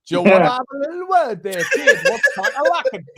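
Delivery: put-on very high squeaky baby talk. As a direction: coo at a little one. Baby-talking to pet or child.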